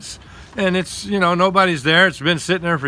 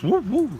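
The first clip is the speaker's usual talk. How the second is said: imitated swaying whoop; 8 semitones higher than usual. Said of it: "going like this".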